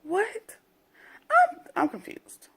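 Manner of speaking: high, slow baffled disbelief; incredulous confusion. Real, not acted. Vlogger baffled by Teddy's turnaround, incredulous and high. Reacting to Teddy's sudden threat.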